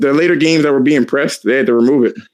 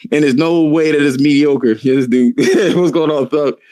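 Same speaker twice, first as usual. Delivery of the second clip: ordinary delivery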